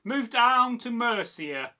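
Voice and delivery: ordinary delivery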